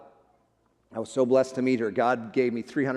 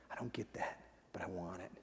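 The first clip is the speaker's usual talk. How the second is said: nearly whispered, much softer, held back by emotion; choked whisper; 7 semitones lower, 19 dB quieter than usual. Real, not acted. Pastor remembering the dying girl's courage, hushed whisper. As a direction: barely get the words out.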